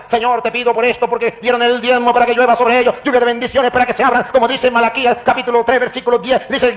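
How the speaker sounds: high, loud rapid-fire challenge; re-enacted indignant tirade; heated rebuke. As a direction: hammer question after question at an opponent.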